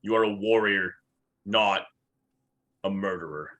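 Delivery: loud, emphatic declaration, easing at the end; stern in-character rebuke. Acted. Orc warrior reproaching a killer, loud and stern. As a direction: proclaim it forcefully, then drop the volume on the last words.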